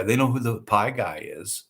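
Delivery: normal talking voice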